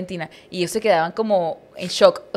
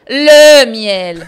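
very loud, drawn-out "Le" on a higher pitch; outraged correction; 4 semitones higher, 15 dB louder than usual. Retold or imitated. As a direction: bellow "Le miel" as if insulted. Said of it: "un insulto, como".

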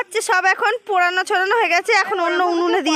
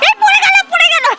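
sudden high alarmed exclamation; startled cry; 13 semitones higher, 4 dB louder than usual. Real, not acted. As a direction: yelp as the chicken drops, much higher and louder. Chicken falls off the fire.

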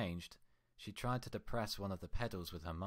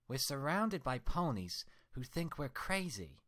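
higher, louder furious scolding; angry Twilight; 7 semitones higher, 5 dB louder than usual. Acted. Twilight scolding her mirror twin, furious and loud. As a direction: tear into her reflection with rage.

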